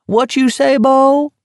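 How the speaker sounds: very high, teasing holler; playful high-pitched call; merry cry. A narrator's reading. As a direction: goad them as if hard of hearing. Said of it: "McVries cried merrily, cupping a hand to his ear".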